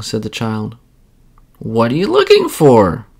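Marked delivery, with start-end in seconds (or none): 1.6-3.1 put-on old woman asking. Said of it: "asked the old woman".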